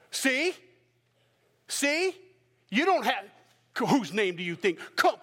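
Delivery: high taunting see? see?; mocking teachers. Teachers of the law jeering, high and taunting. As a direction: jeer at the disciples. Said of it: "the teachers of the law are like".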